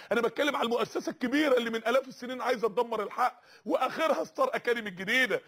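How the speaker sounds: ordinary talk, nothing marked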